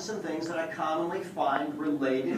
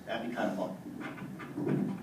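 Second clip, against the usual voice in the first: soft aside; 6 semitones lower, 6 dB quieter, much slower than usual. Real, not acted. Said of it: "soft aside".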